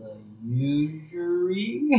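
drawn-out clueless query; Homer, baffled. Acted. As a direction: sound out an unknown word, climbing at the end. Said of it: "And Homer goes".

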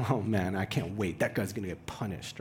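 mock gloating. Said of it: "it's not like I'm saying".

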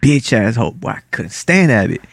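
ordinary talk

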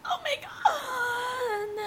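long, high drawn-out "oh my God"; retold shock. Recalling news that someone close got covid, retold shock. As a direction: stretch it out high in dismay. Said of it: "And I was like".

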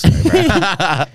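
high, slow, laughing sorry; laughing apology. Real, not acted. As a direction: giggle the apology out. Laughing apology after the joke.

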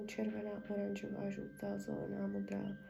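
soft, whispered ritual chant; healing prayer. Acted. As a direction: murmur the blessing over her. (tiché šeptané zaříkávání)

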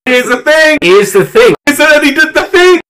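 giddy high-pitched echo; excited mock echo. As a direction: squeal it high, egging the joke on. Excited echoing of the catchphrase.

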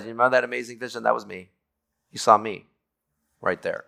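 soft, quick, confiding words as Jesus; hushed Jesus voice. Retold or imitated. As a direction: let him lean in, hushed and intimate. Jesus reveals himself to the priest.

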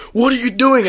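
retelling a yell at guests smoking inside; retold yell. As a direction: snap at the smokers indoors, retold. Retold telling smokers off.

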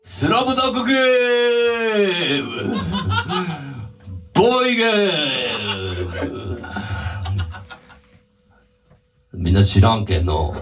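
slow, stretched party shout; drunk party guy. Pretend. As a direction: roar it like a drunk at the afterparty. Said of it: "打ち上げの時の今谷修二". (打ち上げで酔った叫び声)

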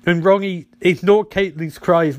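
normal talking voice

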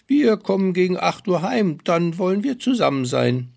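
high, eager little man's voice; a busy dwarf listing chores. Acted. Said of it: "sprach ein Kapuzenmann".

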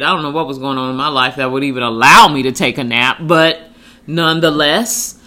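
usual speaking voice

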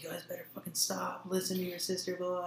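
high, soft mock mom scold; imitating mom telling them off. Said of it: "she's all like".

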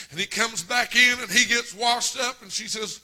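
usual speaking voice

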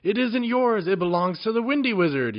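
very high, loud yell over his shoulder. A narrator's reading. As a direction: yell it back while running. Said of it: "yelled Tuppeny".

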